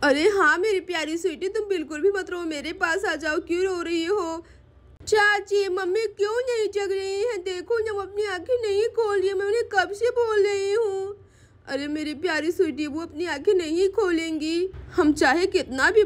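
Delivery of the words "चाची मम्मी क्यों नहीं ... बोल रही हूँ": put-on higher, tearful little-girl voice; child sobbing to her aunt; crying child. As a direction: plead through tears like a small girl. Child asks why mother won't wake.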